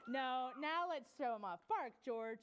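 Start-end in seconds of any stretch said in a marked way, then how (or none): none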